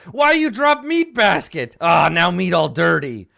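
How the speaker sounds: high, loud squawking gnoll; scolding gnoll complaining about dirty meat; second gnoll. Acted. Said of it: "he's like".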